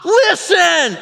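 loud high cry to halt an army; woman shouting over battle noise; commanding shout. Retold or imitated. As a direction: bellow it to stop the crowd. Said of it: "comes out and basically says".

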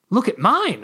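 high, insistent demand. A narrator's reading. Narrator competing to show off a chick, demanding. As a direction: demand attention, pitched well up. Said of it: "I demanded".

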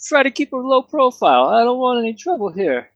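high-pitched Canadian pub keeper; hammed-up Canadian innkeeper greeting guests; mock Canadian accent. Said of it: "And he had an accent".

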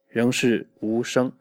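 usual speaking voice

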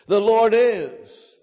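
"is" drawn out and falling; emphasis on IS. Shown as a demonstration. Preacher returning to God's existence, drawn-out "is". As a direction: hold the word "is" with weight. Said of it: "we could place the emphasis on the word is".

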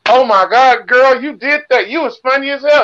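high, loud gushing fan voice. Retold or imitated. Audience members gushing to the comedian, high and loud. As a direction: rave like an excited fan girl. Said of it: "people were like".

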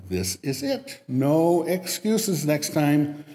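slow, firm professor's warning; stern warning. Retold or imitated. Professor warning his students, slow and stern. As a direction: lay down the law. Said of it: "but warned them".

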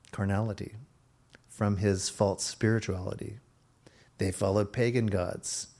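ordinary talk

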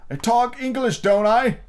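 high, loud indignant frog; affronted old frog; old frog arguing with Alice. A narrator's reading.

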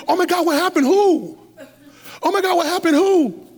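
dad shocked by bad news, hammed up a little; alarmed. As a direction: react like someone just died. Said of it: "And I'm like".

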